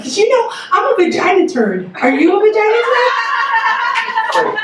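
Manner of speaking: put-on high, excited little-girl voice; a small girl proudly asking everyone; child's proud question. Daughter telling strangers, a high excited kid voice. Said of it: "I heard her ask people".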